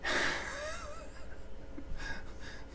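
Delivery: soft, high wheezy laugh; wheezing laugh. Real, not acted. Streamer tickled by his language mix-up, wheezing with laughter. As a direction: laugh breathlessly under your voice. Amused at mixing Spanish into Italian.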